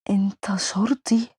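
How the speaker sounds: slow, breathless whisper of shock; stunned whisper. Acted. Crime boss realises the man is police, whispering slowly. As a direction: barely get the words out, stunned.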